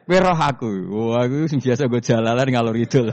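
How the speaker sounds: high laughing "you know me"; giggling playful challenge; laughing retort. Acted. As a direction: tease the angels through laughter.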